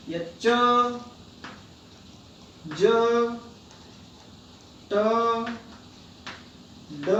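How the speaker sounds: each letter said lightly with no extra breath; अल्प प्राण letters spoken gently; unaspirated letters demo. Shown as a demonstration. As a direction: keep every letter soft and easy. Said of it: "एक बार फिर से मैं थोड़ा आपको बताता हूं".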